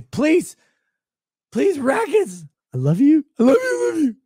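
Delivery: high, loud whiny beggar; mocking begging whine.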